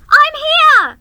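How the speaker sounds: put-on high, loud girl's shout; excited child yelling from far away. A narrator's reading. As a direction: call out loudly to the others. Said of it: "she shouted".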